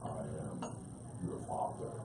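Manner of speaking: very low, soft and resonant; a hushed, deep villain's revelation; deep movie-villain voice. Acted. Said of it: "lean in and really hear".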